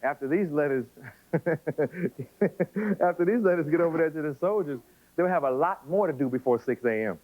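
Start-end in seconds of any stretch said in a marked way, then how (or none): 1.3-4.2 laughing. Real, not acted. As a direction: laugh breaking into a slowed line. Breaks up at own joke.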